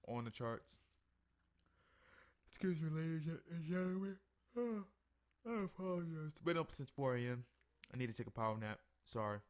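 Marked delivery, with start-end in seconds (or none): 2.5-7.4 words pushed out through a real yawn, higher and louder. Real, not acted. Up since four, apologizing to viewers mid-yawn. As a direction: let a yawn stretch and lift the words. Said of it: "I apologize for yawning".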